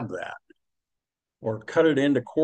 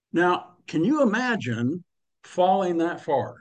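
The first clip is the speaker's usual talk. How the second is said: ordinary talk, nothing marked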